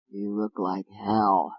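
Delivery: low, slow, quiet self-mutter; weary mutter. Acted. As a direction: mutter it at your reflection. Sick man to his mirror.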